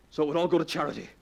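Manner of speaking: usual speaking voice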